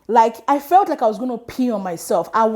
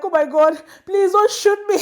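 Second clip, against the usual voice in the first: mock plea; 8 semitones higher than usual. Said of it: "I put my hands up like".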